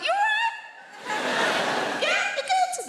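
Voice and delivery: squeaky high sing-song greeting; very high British politeness. Retold or imitated. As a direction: go way up high to mean the opposite. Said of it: "when our voices go high like that".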